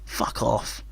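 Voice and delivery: breathy, soft swearing under the breath; hushed despairing curse; whispered curse. Real, not acted. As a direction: mutter it in disgust. Muttered curse at a goal.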